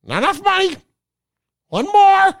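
very high squawky bird voice; toucan voice. Retold or imitated. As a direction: squawk the words like a greedy toucan. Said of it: "done a Toucan impression".

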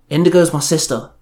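plain reading voice

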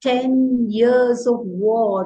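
very slow, drawn-out poem line; haiku recitation. Shown as a demonstration. Teacher reciting the haiku's last line, slow and drawn out. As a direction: let each word hang, half speed.

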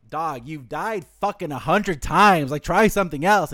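loud, slow, exasperated telling-off; hammed-up scold of a failing fighter; mock Aizen scolding. Aizen voiced scolding Kenpachi, loud and slow.

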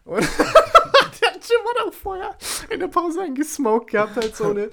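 high giggly voice; trying not to laugh. Real, not acted. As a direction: fight back the giggles. Giggling while retelling.

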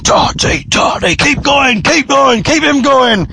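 high-pitched jeering chant; mocking shout. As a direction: egg him on like a taunting crowd. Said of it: "sort of mockingly shouting".

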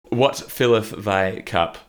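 slow, hammed-up archaic toast; slow mock-medieval host; mock olde English. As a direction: ask it like a pompous bard. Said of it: "mock archaic phrasing".

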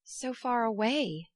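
slow, falling "So far away!" in mild despair. A narrator's reading. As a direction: let "So far away!" sink slowly, dismayed. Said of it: "in a tone of despair".

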